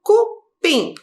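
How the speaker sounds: "Cupim" broken into cu, pim with a gap; syllable separation. Shown as a demonstration. Teacher separating syllables for pupils, syllable by syllable. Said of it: "a gente faz a separação de sílabas".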